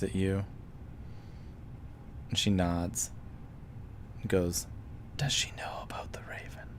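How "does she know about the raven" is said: near-whispered question in character; hushed question. Acted. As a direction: ask it very softly, almost all breath. Game master voices woman asking discreetly.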